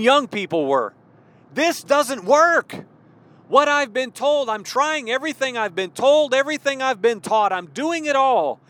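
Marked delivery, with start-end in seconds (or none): 1.5-8.6 exasperated, higher-pitched protest; acted frustration. Young believer protesting to the church that nothing works, frustrated.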